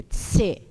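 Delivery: "tse" modelled for repeating, ts onset; isolated "tse", like pizza's zz. Shown as a demonstration. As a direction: say "tse" with t running into s. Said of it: "Repitam comigo".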